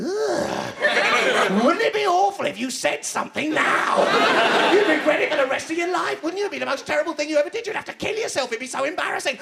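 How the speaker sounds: high, excited, needling inner voice; hammed-up high-pitched tempter; gleefully goading captain. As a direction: egg them on in a high, rising voice. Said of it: "The captain's going".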